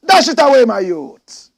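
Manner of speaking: rally the youth; urging youth with a loud cry; loud command. Acted. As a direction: shout it high and loud.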